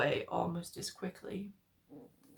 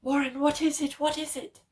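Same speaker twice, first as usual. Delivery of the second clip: high, loud, urgent repeating; frantic call down the line; terrified questions; 8 semitones higher, 6 dB louder than usual. A narrator's reading. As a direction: demand an answer in panic.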